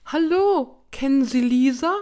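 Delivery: loud, high pleading call; distraught high-pitched cry; desperate. A narrator's reading. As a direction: call out desperately to strangers for help. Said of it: "Oma klang aufrichtig verzweifelt".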